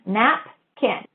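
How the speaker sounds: nap, kin said as two separate beats; syllables said apart. Shown as a demonstration. Teacher modeling syllables for students, said apart. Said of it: "so that's kin".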